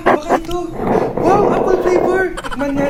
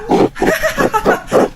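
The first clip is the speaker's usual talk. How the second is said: high mocking laugh; 7 semitones higher than usual.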